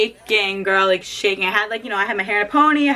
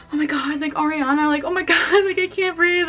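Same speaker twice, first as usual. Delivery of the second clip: higher, giggly fangirl gush; retold starstruck gush; 7 semitones higher than usual. Fan seeing [name] for the first time, gushing. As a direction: babble it breathlessly with a laugh. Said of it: "and I'm like".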